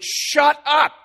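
high, loud blunt command; translated shout. Shown as a demonstration. Preacher rendering the verse as a loud blunt shout. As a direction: bark the words out as a translation. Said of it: "we might translate it".

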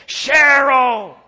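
loud, high drawn-out yell of a name; angry scream. Retold or imitated. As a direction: bellow for your wife across a crowded terminal. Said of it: "he keeps yelling".

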